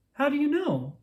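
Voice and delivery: high chirpy little bird voice; chirped. Acted. Little Bird chirps back at Bear, chirpy and high. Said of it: "chirped Little Bird".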